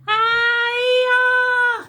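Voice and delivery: very high, very loud yell; a sultan's leap of bravado; battle cry. Acted. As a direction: launch yourself off the tower with a whoop. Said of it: "Here is what it sounded like".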